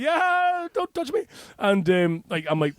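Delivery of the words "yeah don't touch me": much higher, nervous brush-off; high-pitched flustered yeah, don't touch me; squeaky panicked. Retold or imitated. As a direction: squeak it out like a cornered man.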